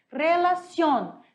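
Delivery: "Relación" slow and loud, stress on the final ción; emphatic word demo. Shown as a demonstration. Teacher presenting "Relación" to the students. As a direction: announce "Relación" slowly, landing on ción. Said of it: "nós sabemos que ela é uma aguda".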